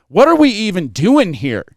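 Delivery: mock outraged complaint; imitated exasperation. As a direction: demand an explanation as the fed-up wife. Retold handler's wife arrives annoyed.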